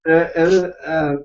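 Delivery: normal talking voice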